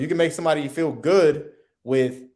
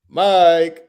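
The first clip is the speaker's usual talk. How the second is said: Mike called much louder and higher; 4 semitones higher, 10 dB louder than usual. Shown as a demonstration. Father shouting son's name, loud call. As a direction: shout his name across the house. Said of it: "all completely different meanings".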